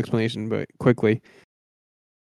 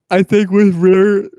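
talking through a yawn; words stretched by a yawn; 7 semitones higher, 5 dB louder than usual. Real, not acted. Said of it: "sorry, I yawned".

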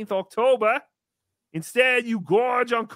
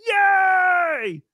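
squealed high Yay, much louder; high cheer; 10 semitones higher, 6 dB louder than usual. Acted. Host announcing the Super Chats segment, with a high cheer. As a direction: cheer like a giddy kid.

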